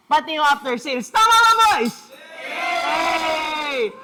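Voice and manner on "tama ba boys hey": high, loud shout to the team; a loud, high call to the crew. Real, not acted. Calling out to the crew.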